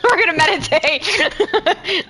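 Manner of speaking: slow, high, laughing through it. Pretend. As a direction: stretch it out while cracking up. Repeats line laughing, slowed.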